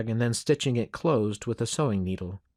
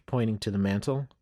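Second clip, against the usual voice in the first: plain reading voice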